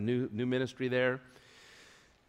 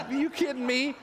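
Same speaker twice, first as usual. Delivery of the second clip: high, light mock surprise; mock disbelief; 10 semitones higher than usual. [name] echoing the pastor's shock, mock surprise. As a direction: toss the question back teasingly.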